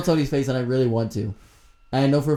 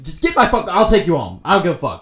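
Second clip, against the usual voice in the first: angry; 6 semitones higher, 7 dB louder than usual. Retold or imitated. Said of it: "And I was like".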